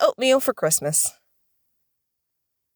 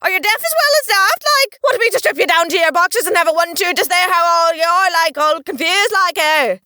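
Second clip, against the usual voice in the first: higher, hammed-up Irish outrage; irate leprechaun; 11 semitones higher, 3 dB louder than usual. Leprechaun berates the daft man, indignant Irish rant. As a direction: scold him in a high Irish brogue.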